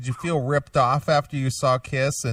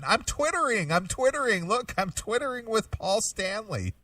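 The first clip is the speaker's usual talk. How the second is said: mock excited fan; 7 semitones higher, 3 dB quieter than usual. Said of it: "people were saying".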